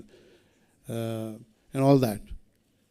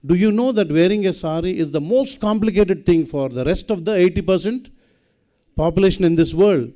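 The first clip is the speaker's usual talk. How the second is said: emphatic loud point; 5 semitones higher, 6 dB louder than usual. Real, not acted. Emphatic rhetorical point to audience.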